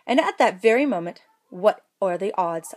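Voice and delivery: plain reading voice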